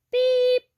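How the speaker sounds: high, loud little truck beep; truck horn beep. A narrator's reading. Blue greets the toad, high beep. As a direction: beep like a small cheerful horn. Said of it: "said Blue".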